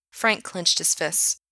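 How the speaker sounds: ordinary delivery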